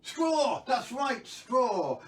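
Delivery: high, loud burst of delight; excited cheer. Real, not acted. Storyteller cheering the right guess from viewers, excited cheer. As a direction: cry out the winning answer.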